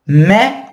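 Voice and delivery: mais said "mè", no s; French mais as mè. Shown as a demonstration. Teacher giving the spoken form of mais. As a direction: clip mais to mè. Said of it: "a gente vai pronunciar mais".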